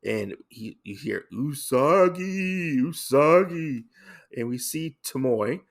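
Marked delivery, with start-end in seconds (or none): 1.3-3.8 high, drawn-out wailing ghost voice; slow, loud, high-pitched mock ghost moan; ghostly call. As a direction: call the name like a haunting spirit. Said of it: "Oh, it's a ghost".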